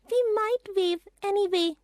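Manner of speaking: put-on high child's voice; a girl offering a hopeful idea; young girl, hopeful. A narrator's reading. A sister answering Peter, high and hopeful.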